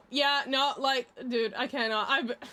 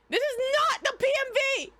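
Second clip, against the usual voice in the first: high startled exclamation; sudden shocked realisation; surprised; 10 semitones higher, 3 dB louder than usual. Real, not acted. Realises the wrong video is on screen.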